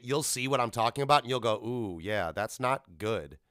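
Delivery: ordinary delivery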